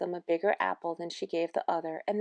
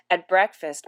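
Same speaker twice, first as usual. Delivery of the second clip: ordinary delivery, nothing marked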